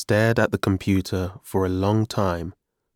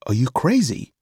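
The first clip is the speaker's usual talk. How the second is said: mildly indignant, higher-pitched retort; 5 semitones higher than usual. A narrator's reading. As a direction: reject the idea crossly.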